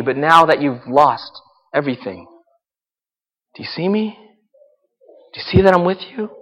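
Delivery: tender God voice. Acted. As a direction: comfort someone at rock bottom. God speaking to the broken.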